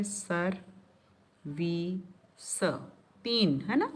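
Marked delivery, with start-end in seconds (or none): 0.0-2.6 "सर, वी, स" as three separate syllables with pauses. Shown as a demonstration. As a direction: break service into "सर", "वी", "स", one at a time. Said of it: "इसमें कितने सारे syllables आ गए".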